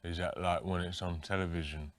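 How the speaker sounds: dopey mumbling [name]. Pretend. [name] spoofed answering the interviewer, dim and mumbling. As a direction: sound lost and easily confused.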